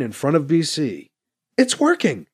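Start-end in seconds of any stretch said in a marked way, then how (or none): 1.6-2.3 higher, eager voice; excited. Acted. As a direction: blurt it out with glee. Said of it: "says excitedly".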